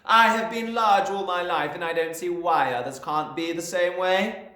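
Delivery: loud, high haughty retort; haughty headmistress. A narrator's reading. Headmistress boasting to the class, haughty. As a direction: declare it proudly.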